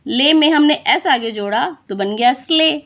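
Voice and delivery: ordinary delivery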